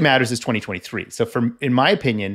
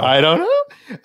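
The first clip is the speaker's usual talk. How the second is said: hammed-up high-pitched uncertainty; mock octave-high voice; 7 semitones higher, 5 dB louder, much slower than usual. Teased about disclosure, mock high voice. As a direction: play up a high, loud squeak. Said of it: "your voice goes up an octave".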